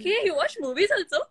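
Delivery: high surprised voice; surprised question. Retold or imitated. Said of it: "So I was like".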